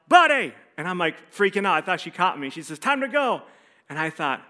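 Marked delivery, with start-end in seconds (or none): 0.1-0.5 high, loud call across a store; mom's yell. Retold or imitated. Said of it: "I heard my mom yell". 2.8-3.4 mom calling out. Retold or imitated. Mom calls the boy over.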